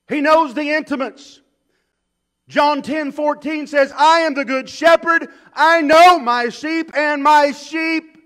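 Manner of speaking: loud and high, slowing on the sheep; booming pulpit delivery; full-force preaching. Acted. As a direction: preach it out to the congregation.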